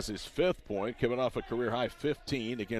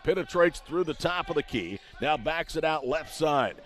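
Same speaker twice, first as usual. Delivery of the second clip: raised, driving live call; excited play-by-play; 3 semitones higher than usual. Real, not acted.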